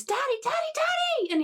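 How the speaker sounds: put-on very high, squealing little girl; delighted little daughter; gleeful child. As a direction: squeal for your father as you run to him. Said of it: "you hear a gleeful voice".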